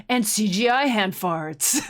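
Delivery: a hint of a cool movie-trailer narrator; lightly voiced trailer announcer, slow; cool guy trailer voice. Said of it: "he's like".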